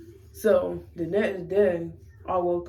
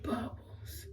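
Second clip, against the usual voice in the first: unvoiced whispered name; whispering his name to shut him down; 4 semitones higher, 16 dB quieter than usual. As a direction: hiss his name softly.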